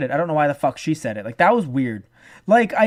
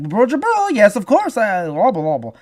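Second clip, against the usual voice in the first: mock babble; 3 semitones higher, 4 dB louder than usual. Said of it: "it's just like".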